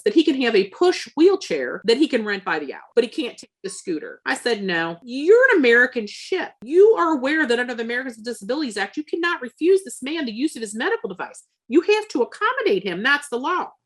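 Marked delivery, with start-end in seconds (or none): 4.6-13.7 raised, higher voice laying down the law; emphatic retold protest; indignant insistence. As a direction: lecture an official, firmly outraged. Lecturing ship's officer on the law.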